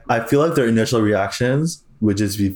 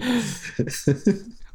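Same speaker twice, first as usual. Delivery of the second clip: high laugh; 11 semitones higher, 5 dB quieter than usual. Real, not acted. Laughing at the imagined scene.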